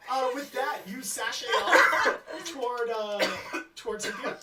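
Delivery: laughing through the words. Real, not acted. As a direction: narrate while cracking up. GM narrating while laughing.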